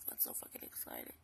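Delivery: whispered deadpan sarcasm; sarcastic whisper. Real, not acted. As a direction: breathe it out with dry sarcasm. Sarcastic aside about boring school.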